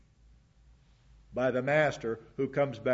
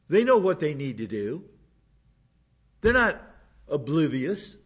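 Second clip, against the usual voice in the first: emphatic; 2 semitones higher, 6 dB louder than usual. Real, not acted. Pastor insisting to the church, raised emphatic voice. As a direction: press it hard, they are not oblivious.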